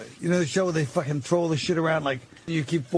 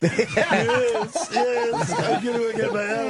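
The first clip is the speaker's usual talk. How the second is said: lightly voiced cocky comic, laughing; mocking impression of Boss; 3 semitones higher, slower than usual. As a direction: mimic the guest mockingly. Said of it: "I don't like Boss's portrayal of me".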